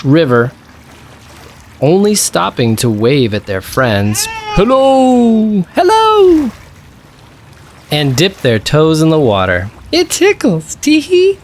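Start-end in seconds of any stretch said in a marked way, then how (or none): 4.6-6.5 high, louder cheerful hellos; cub calling hello. Acted. Cub greeting woodland friends from the boat, high and cheery. 9.9-11.4 high, giggling cub voice; tickled toes, squeal it out; giggly little cub. Acted. Cub dipping toes in the river, high and giggly.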